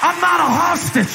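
high, shouted sermon climax; rally the church at the top of your voice; full-force preaching. Acted.

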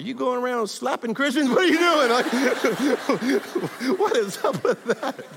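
mock outrage that dissolves into laughter; laughing mock outrage. Laughing at the officer who slapped him as a test.

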